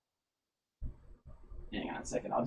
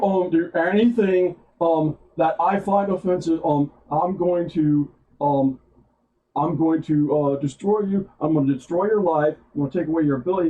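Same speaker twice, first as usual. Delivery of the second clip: retold cancel-culture impression; 4 semitones higher, 4 dB louder than usual. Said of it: "his next one, he's like".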